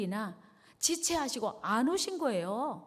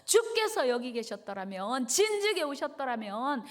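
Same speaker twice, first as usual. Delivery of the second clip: raised, reproachful acted outburst; resentful complaint; 5 semitones higher, 4 dB louder than usual. Martha to Jesus who came too late, resentful complaint. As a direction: pour out the grievance, pitch climbing. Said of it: "막 원망 불평을 막 쏟아낸 거예요".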